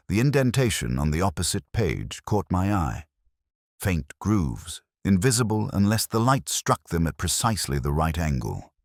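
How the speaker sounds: usual speaking voice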